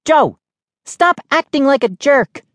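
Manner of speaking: high-pitched, irritated scolding; snapped. A narrator's reading. Sister scolding teasing sibling, snapping. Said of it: "she snapped".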